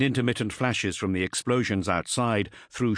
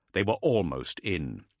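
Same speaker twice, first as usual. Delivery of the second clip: ordinary delivery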